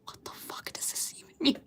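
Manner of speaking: real stunned whisper. Real, not acted. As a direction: hush it to yourself, baffled. Baffled at the drawing.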